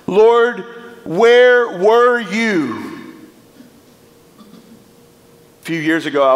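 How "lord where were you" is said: high, loud anguished plea to God; anguished cry. Acted. Preacher crying out to God for the grieving. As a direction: cry it out, slow and stretched. Climax of the repeated lament.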